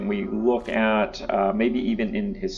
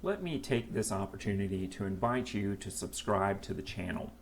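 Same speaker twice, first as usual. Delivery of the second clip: softer, heartfelt outro-style read; sincere channel plea; 11 dB quieter than usual. Acted. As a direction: talk to viewers softly and earnestly. Sincere subscribe plea to viewers.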